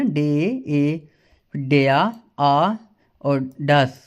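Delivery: डे stretched out very slowly to show the ए; slow, sound-by-sound डे, ए; German example words. Shown as a demonstration. Teacher stretching out the sounds for students. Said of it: "ए की आवाज़ आ रही है ना".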